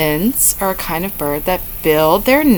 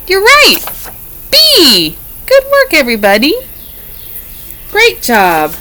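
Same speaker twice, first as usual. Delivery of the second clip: excited praise; 9 semitones higher, 3 dB louder than usual. Real, not acted. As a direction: cheer them on with delight. Praising the children.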